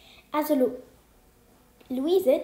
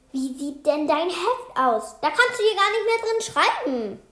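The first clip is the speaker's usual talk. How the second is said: scolding teacher; 5 semitones higher, 4 dB louder than usual. A narrator's reading. Teacher scolds boy over wet notebook.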